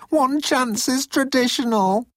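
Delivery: high, slow pleading; begging. A narrator's reading. Moan argues for one last chance, begging. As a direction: plead your case, voice way up. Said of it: "Moan begged".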